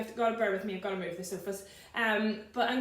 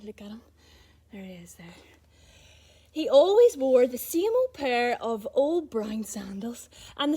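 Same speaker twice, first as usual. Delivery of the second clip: slow, breathy read-aloud for little ones; storybook reading; slower than usual. Acted. Reading a bedtime story to kids, hushed and slow. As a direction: draw the children into the story gently. Reading picture book to children.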